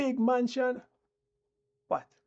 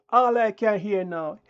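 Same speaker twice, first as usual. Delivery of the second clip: usual speaking voice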